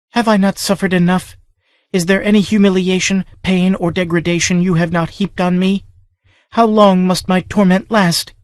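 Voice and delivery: high, despairing plea; a young woman worn down by torment; pleading girl. A narrator's reading. Captive girl begging her tormentor, high and despairing.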